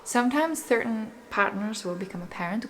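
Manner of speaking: ordinary delivery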